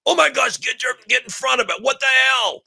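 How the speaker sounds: high, loud mock shout at a kid; angry baseball dad yelling from the stands. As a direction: bellow like a dad furious at a missed grounder. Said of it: "the dad's going".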